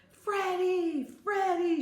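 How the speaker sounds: high, loud searching call. A narrator's reading. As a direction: call for a missing child, drawing out the name. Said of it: "she called".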